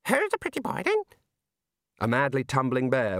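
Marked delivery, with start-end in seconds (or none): 0.1-1.0 very high squawky parrot voice; squawking budgerigar. Acted. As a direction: screech it like a parrot.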